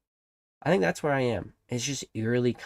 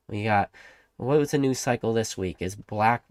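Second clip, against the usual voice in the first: ordinary talk, nothing marked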